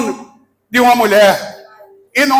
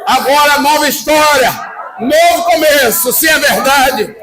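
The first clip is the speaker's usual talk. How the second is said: fiery preaching. Acted. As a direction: preach it full force to the crowd. Preacher fires up congregation.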